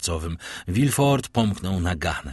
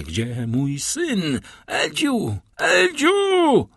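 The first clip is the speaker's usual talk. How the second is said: crying out; 8 semitones higher, much slower than usual. A narrator's reading. Father suddenly seeking his son, building to desperate screams. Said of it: "zawołał nagle".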